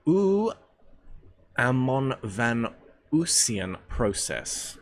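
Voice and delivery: Uamonvanusian split into careful chunks; Uamonvanusian sounded out. Shown as a demonstration. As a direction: pause between each piece of Uamonvanusian. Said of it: "you go mon and then there's a van".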